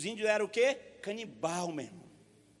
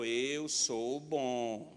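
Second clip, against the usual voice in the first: voice of God; 10 semitones lower, 4 dB quieter, much slower than usual. Acted.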